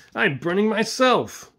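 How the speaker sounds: high, loud, drawn-out shout; crying out. A narrator's reading. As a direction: cry out in sudden pain.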